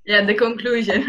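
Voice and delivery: usual speaking voice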